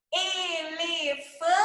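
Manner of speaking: "Elefante" slowly split into e, le, fan, te; one slow beat per syllable in "Elefante". Shown as a demonstration. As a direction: segment "Elefante" with even gaps. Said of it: "brincadeira de segmentação silábica".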